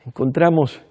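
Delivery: ordinary talk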